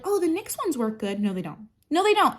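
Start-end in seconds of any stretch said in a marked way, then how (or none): 0.0-1.2 higher mock of other people. Mocking people who recommend cheap lip liners. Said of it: "people are like". 1.9-2.3 high, loud flat denial; emphatic no. Real, not acted. Rejecting the nyx recommendation, high and loud.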